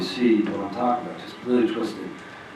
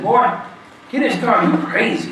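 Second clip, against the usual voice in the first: high, loud commanding call from a car; gruff stranger; 11 semitones higher, 4 dB louder than usual. Retold or imitated. Man in a car ordering a walker in the cold, loud. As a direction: bark it like a stranger pulling over. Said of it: "that's what exactly how he talked".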